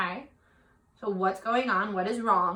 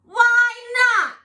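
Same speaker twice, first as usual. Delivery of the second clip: high, loud, complaining frog voice; a small frog pleading and whining; whiny little frog; 11 semitones higher, 8 dB louder than usual. Acted. Frog protests in story.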